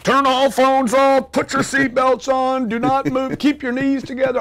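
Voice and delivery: high, slow mocking attendant voice; sing-song parody of cabin rules; lampooning the safety announcement in a prissy high voice; mock flight attendant.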